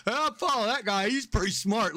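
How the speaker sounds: high, gushing fake awe; mock admiration. Mocking listeners won over by a British accent. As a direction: play the easily impressed fan. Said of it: "I'm like".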